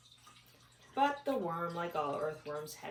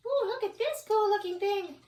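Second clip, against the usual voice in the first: put-on high girl voice; 6 dB louder than usual. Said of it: "she said".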